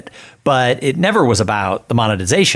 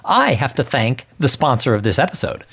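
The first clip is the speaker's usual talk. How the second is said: sponsor read; 5 semitones higher than usual. Acted. Host reads sponsor ad to podcast listeners, upbeat promo voice. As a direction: sell the sponsor like a radio spot.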